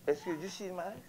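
ordinary talk

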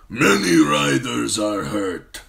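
slow, loud warlord refusal; stubborn khal. Acted. Wounded khal stubbornly refuses healing, slow loud. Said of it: "Khal Drogo said stubbornly".

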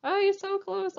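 ordinary delivery

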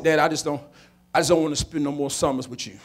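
put-on low, soft withdrawn boy; reluctant son. Son tells his dad he is done with summers, subdued. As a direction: let it out low and quiet, reluctantly.

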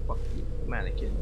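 fast breathy whisper; whispered curse. Real, not acted. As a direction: hiss it under your breath, quick. Muttered at a creepy mannequin.

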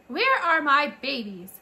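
high, loud weary cry for her babies; exhausted mother mouse. A narrator's reading. New mother mouse calling for her babies after the birth, weary. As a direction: call out for the babies, worn out.

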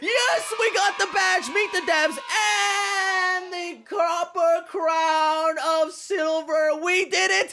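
overjoyed gamer cheering his badge; triumphant shouting. Real, not acted. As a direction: celebrate the win at full pitch. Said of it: "I am so excited!".

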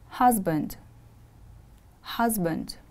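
"Husband" stressed on HUS, falling away; stress on first syllable. Shown as a demonstration. Teacher models "Husband" for students to repeat, HUS stressed. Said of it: "The stress is on the first syllable".

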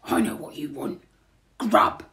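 low burglar voice, last word punched; deep, loud final word; burglar character. A narrator's reading. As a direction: announce it low and knowing. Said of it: "Then Burglar Bill says".

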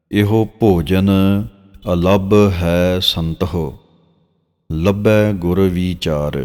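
drawn-out, measured reading of sacred verse; slow scripture recitation. Shown as a demonstration. Teacher reciting Gurbani verse for students, slow and measured. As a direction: recite each phrase slowly and reverently.

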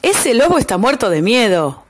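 usual speaking voice

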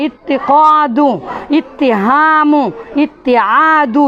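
each ইত্তি word read slowly and distinctly; slow word list. Shown as a demonstration. Teacher reads a list of examples to students, slow. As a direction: recite the examples one by one, slowly. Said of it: "দেখেন".

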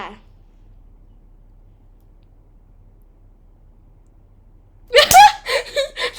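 high, loud burst of laughter; shrieking laugh. Real, not acted. Laughing fit at camera.

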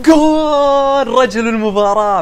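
long high goal yell sliding into a proud slow boast; drawn-out triumphant goal call; stretched goal shout. Real, not acted. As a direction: hold the goal cry then crown yourself. Scores a winning goal.

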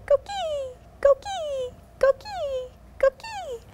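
high, soft chirping frog calls; coqui frog call. Acted. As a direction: sing it softly like a tiny frog. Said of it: "They sing softly".

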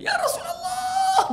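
a slow, very high cry of reunion; joyful cry. Acted. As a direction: call out with all your longing. Thauban greets Prophet on return.

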